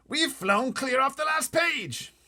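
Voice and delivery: high, loud, slow wail; pompous officer aghast; panicky Air Force chief. Acted. Air Force head crying out to the pilot in alarm.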